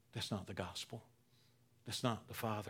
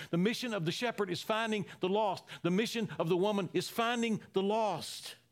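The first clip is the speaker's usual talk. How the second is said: higher and louder, fervent preaching; preaching at full force; 8 semitones higher, 4 dB louder than usual. Acted. Preacher proclaiming the father's mission to find the lost, fervent. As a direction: let the joy ring out over the room. Preacher rising in fervor again.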